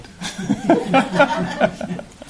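high, breathy burst of real laughter. Real, not acted. Laughs after greeting the group.

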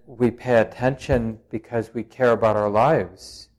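usual speaking voice

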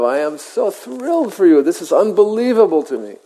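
high, fast, gushing with joy; ebullient. Retold or imitated. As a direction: rave like a thrilled fortune teller. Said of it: "And he gets ebullient".

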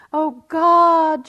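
a high, loud, desperate call to the sky; shouting again. Retold or imitated. Atheist on the cliff shouts to God again. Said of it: "she shouted again".

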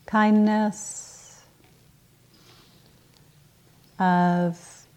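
unhurried meditation guidance with long pauses; guided meditation. Acted. Teacher settles the group into morning practice, calm guided-meditation delivery. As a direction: ease them gently into stillness, leaving space between phrases.

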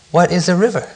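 high, wondering question; curious. A narrator's reading. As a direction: lift the voice in innocent curiosity. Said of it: "Mouse asked curiously".

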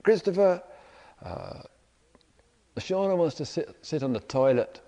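mild mock call from downstairs; retold shout. Partner calls up from the kitchen, mock shout. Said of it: "shouts up to me".

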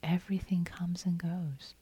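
soft whisper on "Everything comes and goes". Real, not acted. Teacher repeating the teaching to retreatants, whispered.

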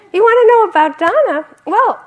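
high, loud, gleeful outburst; animated monk. Retold or imitated. Laughing monk answers manager's question about generosity, animated high shout. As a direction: cry it out with uproarious delight. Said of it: "he laughs and laughs and laughs".

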